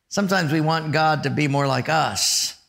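ordinary talk

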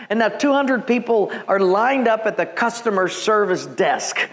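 ordinary talk, nothing marked

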